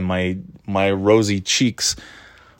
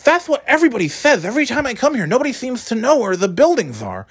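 much higher, complaining tone; high voiced driver; 14 semitones higher than usual. Delivery woman complains to the narrator in a much higher voice. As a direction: whine that nobody knows.

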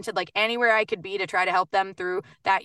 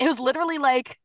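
mock upbeat promo; 8 semitones higher than usual. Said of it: "I was like".

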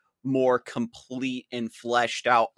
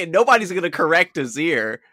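normal talking voice

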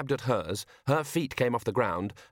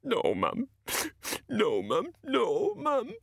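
rising higher, sobbing plea; crying; 12 semitones higher, much slower than usual. A narrator's reading. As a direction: break down crying to mum. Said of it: "Medium Dave started to cry".

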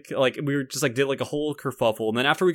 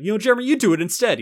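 higher, louder, hurried mock plea; mimicking himself; 11 semitones higher, 8 dB louder, faster than usual. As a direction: act out handing it over, quick and high. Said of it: "like, mimicking".

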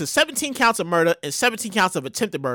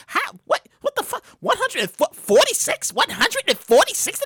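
high, disbelieving cry; high shocked outburst; stunned; 8 semitones higher than usual. Real, not acted. Disbelief at death toll.

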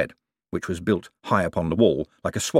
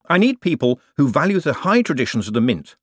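raised, projected speech to workers; smooth boss pitch; 7 semitones higher, 4 dB louder than usual. Acted. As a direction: sell it to the men with assurance. Moist addresses the mint men.

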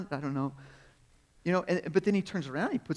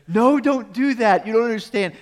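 mock warning; 8 semitones higher, 10 dB louder than usual. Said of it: "put out these warnings that said".

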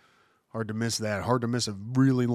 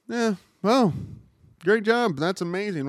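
mock bored, flat praise; unimpressed reaction; 8 semitones higher than usual. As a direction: shrug it off like it's nothing special. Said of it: "and go".

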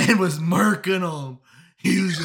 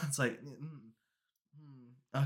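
ordinary talk

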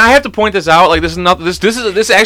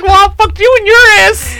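high, lightly put-on voice of the girlfriend; a hint of a mocking girlfriend; 12 semitones higher than usual. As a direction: parody her comeback in a high voice.

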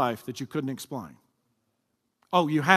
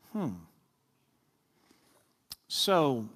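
ordinary talk

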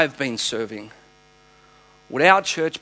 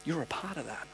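much softer, hushed and gentle; tender soft voice of Jesus; 2 semitones lower, 12 dB quieter than usual. Retold or imitated. Jesus tenderly blessing his faithful son, softly. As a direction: speak it like a loving father's blessing. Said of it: "And he said".

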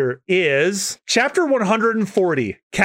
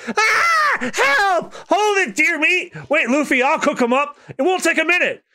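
high, frantic little critter voice; panicked squeaky Chopper; 11 semitones higher than usual. Acted. Chopper panicking while chased.

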